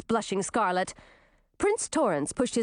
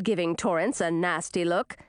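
ordinary delivery

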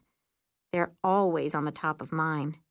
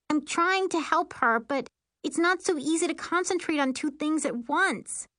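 high-pitched, defensive complaint; flustered protest; 9 semitones higher than usual. A narrator's reading. Sister juggling two spells, flustered.

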